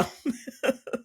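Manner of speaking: breathless high giggle; airy laugh. Real, not acted. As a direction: giggle without voice. Laughing at the story.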